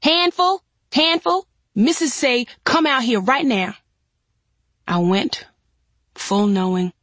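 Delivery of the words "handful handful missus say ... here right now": high, urgent calling out; raised-pitch narrated yell for someone. A narrator's reading. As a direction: yell across the yard, high and insistent. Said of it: "she yelled".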